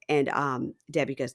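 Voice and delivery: usual speaking voice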